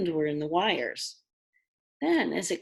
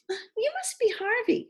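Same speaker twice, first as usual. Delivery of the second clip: put-on girl's voice. A narrator's reading. Lulu greets Harvey, high girl voice.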